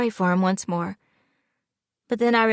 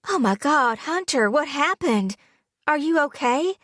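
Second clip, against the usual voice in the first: much higher, alarmed and breathless; gasped; 8 semitones higher than usual. A narrator's reading. Fiancée seeing his burned hand, gasping alarm. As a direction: gasp it out at the sight of burns. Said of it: "I gasped".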